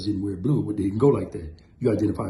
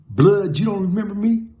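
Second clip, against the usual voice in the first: loud challenge; 8 semitones higher, 7 dB louder than usual. Retold or imitated. Dude confronts him in wash house.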